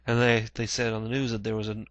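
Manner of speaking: ordinary talk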